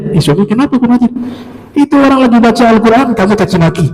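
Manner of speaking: mock scolding. Wife scolds husband in story.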